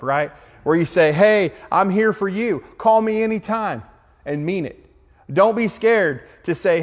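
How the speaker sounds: rally the room to reach out; fervent preaching. Acted. Preaching at full force to group.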